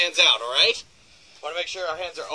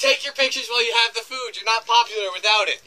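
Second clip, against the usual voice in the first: ordinary talk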